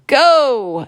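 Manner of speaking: sharp, high, loud "Go!"; witch's holler. Acted. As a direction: yell the start like a race caller. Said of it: "Then she hollered".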